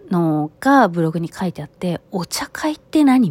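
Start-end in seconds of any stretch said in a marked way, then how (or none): 2.1-3.3 high, loud puzzled question; baffled and caught off guard; surprised. Retold or imitated. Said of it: "みたいな".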